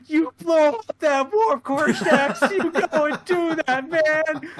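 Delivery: high, loud drawn-out yell; cheering engineers. Acted. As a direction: holler encouragement across the engine room. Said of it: "all the engineers like".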